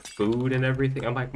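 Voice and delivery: ordinary delivery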